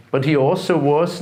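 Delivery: ordinary delivery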